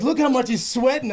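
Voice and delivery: high, laughing imitation of a passenger; mock passenger. Quoting a passenger about his sweat, laughing imitation. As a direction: mimic the gawker while laughing. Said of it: "someone's like".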